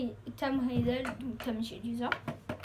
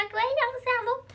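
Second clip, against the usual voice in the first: mock TikTokers; 10 semitones higher, 4 dB louder than usual. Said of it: "les gens, là, qui font des TikTok".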